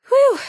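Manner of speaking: loud, high whew of relief; relieved whew. Acted. Companion relieved after the standoff, loud whew. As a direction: blow out a big sigh of relief.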